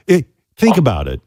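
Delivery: ordinary talk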